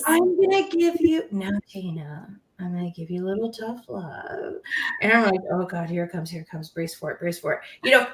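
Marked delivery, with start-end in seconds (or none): 0.1-4.5 imitated coach voice sinking low and soft; Kim. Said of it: "You start the downward inflection". 5.5-7.6 low, hushed mock dread; bracing herself. Retelling bracing for tough love.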